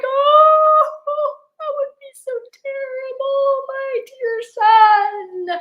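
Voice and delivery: high sobbing wail; crying. Acted. Daughter weeping over imagined son, high sobbing. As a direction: sob it out, voice breaking high. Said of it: "she started crying".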